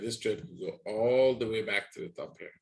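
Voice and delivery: usual speaking voice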